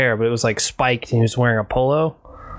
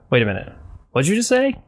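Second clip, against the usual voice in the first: surprised double take; 6 semitones higher than usual. Retold or imitated. Catching a Canadian pronunciation.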